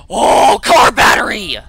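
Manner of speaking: gasp, then loud exclamation; high, loud burst of excitement; excited discovery. Real, not acted. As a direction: shout it out on finding the prize. Startled find of needed item.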